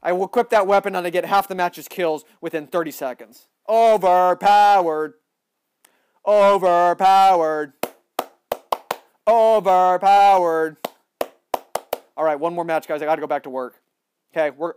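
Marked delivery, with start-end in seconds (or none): 3.7-5.1 slow, drawn-out and louder; mock dramatic. Gamer mock-announcing his weapon, drawn out. 6.2-7.7 slow, drawn-out and louder; mock dramatic. Gamer mock-announcing his weapon, drawn out. Repeated mock hype chant. 9.3-10.8 slow, drawn-out and louder; mock dramatic. Gamer mock-announcing his weapon, drawn out. Repeated mock hype chant.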